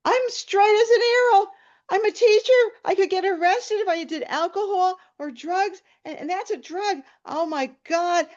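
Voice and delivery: high, loud, hammed-up hysteria; panicked meltdown. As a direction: protest in escalating mock panic, voice way up. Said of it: "I was having a nervous breakdown".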